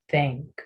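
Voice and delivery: "Thank you" with TH as a Spanish Z, tongue at the upper teeth; soft th in thank. Shown as a demonstration. Teacher modelling "Thank you" with the tongue on the teeth. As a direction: start "Thank" with a lisped th, not a T. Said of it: "sonido de T H".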